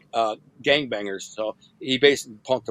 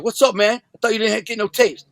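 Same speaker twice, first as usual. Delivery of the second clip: higher-pitched retold confrontation, mildly angry; mad; 7 semitones higher than usual. Nunu comes back to the cell to confront him, mad. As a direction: imitate an annoyed man demanding answers. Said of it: "and, like, mad".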